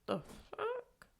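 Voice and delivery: a sharp squeal of disbelief; high-pitched mock outrage; stunned disbelief. Reacting again to him leaving.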